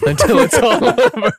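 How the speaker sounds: words breaking up into high laughter; helpless giggle through the words; laughing. Real, not acted. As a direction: crack up as you finish the joke. Cracking up at own gross joke.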